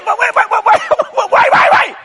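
high, loud, laughing repeated wait; frantic begging. Retold or imitated. Husband pleading with wife, frantic high laughing wait. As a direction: beg her to wait, voice climbing high.

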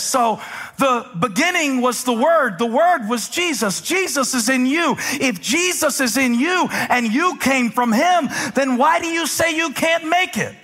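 high, driving sermon climax; building full-force preaching. Real, not acted. Preacher urging believers that Jesus is in them, building preaching. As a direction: build phrase on phrase with pulpit fire.

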